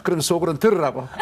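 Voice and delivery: ordinary delivery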